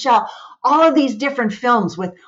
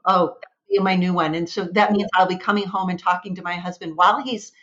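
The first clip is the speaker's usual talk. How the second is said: ordinary talk, nothing marked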